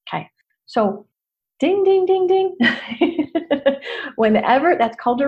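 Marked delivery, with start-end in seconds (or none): 1.6-3.3 high sing-song "ding, ding, ding, ding"; mock alarm bell. Coach signalling a regression with high mock dings. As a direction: chime "ding" like a bell going off.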